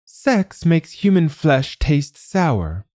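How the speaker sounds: very high, slow, castrato-like dragon; a lofty creature singing out its reasoning; beautiful dragon voice. A narrator's reading. Dragon explaining its taste to the negotiator, high and slow. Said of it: "it said in its beautiful voice".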